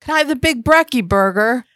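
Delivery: high-pitched, put-on polite request; pretend customer ordering. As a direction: play a customer, lifting into the question. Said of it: "Will you pretend you're ordering".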